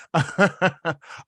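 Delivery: a run of quick chuckles; amused laugh. Real, not acted. Astrologer tells viewers not to get funny, amused chuckling. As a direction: chuckle at your own cheeky joke. Laughs at own pronunciation joke.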